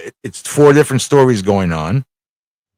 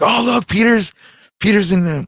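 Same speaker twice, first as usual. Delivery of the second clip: excited discovery; 11 semitones higher than usual. Retold or imitated. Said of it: "So like".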